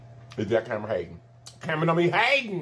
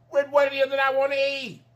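much higher, playful sing-song; high teasing voice; 13 semitones higher than usual. Real, not acted. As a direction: tease them, pitch way up. Repeats line teasingly higher.

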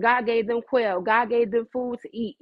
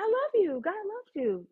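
high, soft, lilting reassurance; sweet affection; 8 semitones higher, 5 dB quieter, slower than usual. Acted. Telling departing scoffers they are loved, sweet. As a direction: coo it softly and high. Sweetly greeting those who exit.

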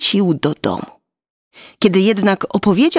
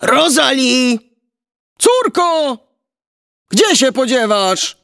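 mildly raised, high-pitched call; giant calling out; 5 semitones higher than usual. A narrator's reading. Giant father looking for his daughter, calling out. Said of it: "Olbrzym zawołał znowu".

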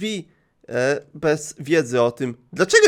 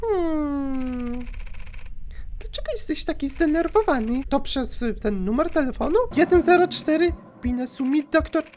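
creepy caller voice; 10 semitones higher, 6 dB quieter than usual. Acted. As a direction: purr it like a creepy stalker. Głos stalkerki, szept. (wysoki, cichy, złowieszczy głos dzwoniącej)

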